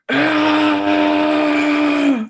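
long, high, loud held scream; hammed-up drawn-out shriek; mock scream. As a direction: imitate an overacting actor's sustained wail. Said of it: "The way he's just".

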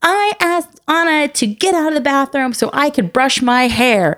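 high-pitched imitation of a complaining child; Bella. Daughter tattling to mom in the bathroom, high mock complaint. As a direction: tattle like a kid to a parent. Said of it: "I had Bella come in and go".

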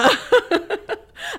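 high peal of laughter; laughing. Real, not acted. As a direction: laugh freely at yourself. Laughs after calling herself bossy.

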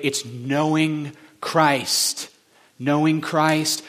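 slow, drawn-out weight on knowing; slow emphasis. Real, not acted. Preacher naming the one thing, slow. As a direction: stretch each word out. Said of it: "slowed for emphasis".

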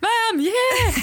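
high-pitched calling through the house; high mock yell between rooms; mock shouting. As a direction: holler it up high, mock long-distance chat. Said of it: "Have a conversation through shouting".

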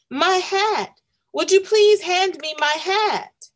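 light up at seeing the lost hat; excited cry. A narrator's reading. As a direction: Milo calls out for his hat. Milo spots his stolen hat.

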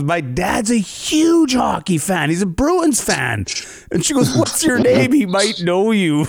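high, slow, eager mock stewardess. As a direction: lay it on thick as the proud fan's daughter.

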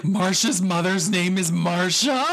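incredulous question, rising sharply at the end; astonished double-check with a big final lift; retold disbelief. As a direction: replay your baffled surprise. Retold shock at a mistaken name.

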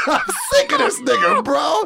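high, laughing half-shout; cracking up while venting; laughing exasperation. Real, not acted. Host vents about the guard, laughing and shouting high.

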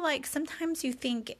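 ordinary talk

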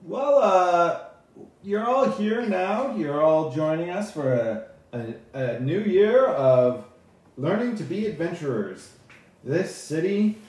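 loud, high, slow address; stagey speech to new students, with pauses; high elf orator, projected and drawn out; high elf speaker. Acted. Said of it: "and he says".

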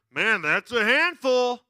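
slow, loud, put-on dismay; mock amazement. Shopper eyeing four kids, exaggerated dismay. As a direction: imitate a stranger who is overwhelmed for you. Mimics strangers commenting on his kids.

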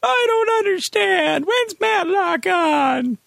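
put-on very high, slow old man; baffled old man calling out. Confused old man bit.

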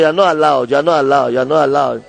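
loud, insistent chant; emphatic exhortation. Acted. Preacher has neighbours tell each other, loudly insistent. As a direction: drum it in loudly, line after line. Rallies listeners to repeat a command.

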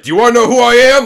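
loud, high, quick hammed-up challenge; mock dramatic reveal. Host acting out the character's season-end reveal, hammed-up loud challenge. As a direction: build up a mock big reveal.

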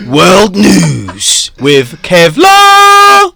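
slow, drawn-out show intro; announcer voice. Acted. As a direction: announce it like a news anchor. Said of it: "Right, let's do it".

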